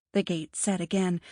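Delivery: usual speaking voice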